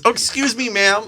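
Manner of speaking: high, meek excuse me, ma'am; mock timid guy. Imitating the man apologizing to the woman, high and meek. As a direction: squeak the apology. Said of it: "And then he goes".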